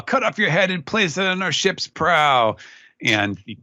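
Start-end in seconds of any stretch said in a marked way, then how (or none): 0.0-2.5 higher, lightly acted boast; mock taunt. Host as Kid taunting his foe, mild bravado. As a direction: call out a playful villain's threat. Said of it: "he's taunting Kaido now".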